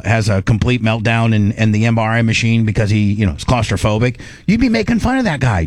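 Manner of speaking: ordinary talk, nothing marked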